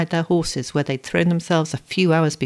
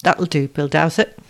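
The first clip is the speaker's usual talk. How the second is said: plain reading voice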